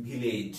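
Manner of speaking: "ভিলেজ" with breathy bh and long e, "bhi-lej"; Bengali-style village. Shown as a demonstration. Teacher showing learners how Bengalis say "ভিলেজ": bhi-lej, bh for v. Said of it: "আমরা বাঙালিরা কিভাবে বলি?".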